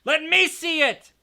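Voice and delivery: high, loud, slow demand; Dudley demanding the letter. A narrator's reading. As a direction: insist like a spoiled boy. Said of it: "demanded Dudley".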